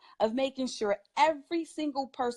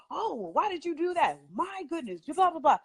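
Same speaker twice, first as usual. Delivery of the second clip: higher-pitched exasperated parent imitation; mock parental scolding; 6 semitones higher than usual. Parents reacting to a misbehaving child, mock exasperated scolding. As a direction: play up a fussing parent's dismay. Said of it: "Parents are so".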